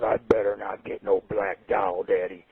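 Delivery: put-on low, slow, sulky kid voice; a lightly voiced grumbling son; a hint of a pouting kid complaining to his dad; mad little son. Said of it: "And he's mad this year".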